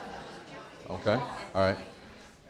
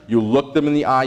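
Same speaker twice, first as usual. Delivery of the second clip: normal talking voice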